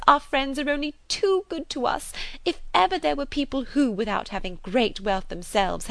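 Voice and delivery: high, gushing spinster, swooping pitch; fulsome, overwhelmed gratitude; effusive chatterbox. A narrator's reading. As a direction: overflow with thanks and excitement.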